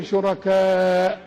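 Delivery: "شركاء" sung out very slowly; spelled-out word. Shown as a demonstration. Sheikh drawing out the word for students, slow chant. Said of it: "كاف وألف وهمزة".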